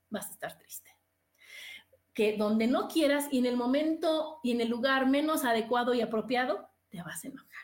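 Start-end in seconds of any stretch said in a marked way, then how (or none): 0.1-0.9 whispered, lower and much softer; hushed warning. Shown as a demonstration. Host warns listeners of sadness building up, hushed. Emotion arrives despite not wanting. 6.9-7.5 whispered, far softer and lower; hushed warning. Shown as a demonstration. Host warns that anger comes out unwanted, hushed. Anger arrives at worst moment.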